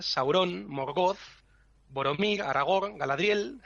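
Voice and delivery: "Sauron, Morgoth, Boromir, Aragorn, Galadriel" each stressed on the last syllable; wrong final stress. Shown as a demonstration. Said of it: "todo lo que nosotros decíamos". ("Sauron, Morgoth, Boromir, Aragorn, Galadriel" como agudas, acentuando la última sílaba)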